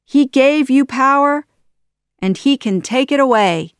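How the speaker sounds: high, loud, goading delivery; taunting. A narrator's reading. Aladdin goads Jafar about his power, loud and taunting. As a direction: needle the villain with it. Hero keeps goading the villain.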